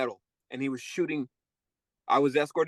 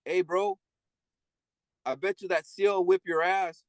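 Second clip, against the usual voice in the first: pitched higher, a lightly voiced taunting inmate; mocking inmate voice; 6 semitones higher than usual. Old inmate tells a new one the guard will beat him: higher mock voice. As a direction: tease him like an old con egging on a fight.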